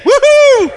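high, loud "woohoo"; cheering whoop. Pretend. Speaker hyping the crowd for Groundhog Day, high cheering whoop. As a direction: let out a high-pitched whoop.

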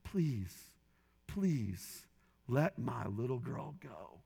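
lightly voiced desperate father's plea; pleading father. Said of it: "he pleaded with those kidnappers".

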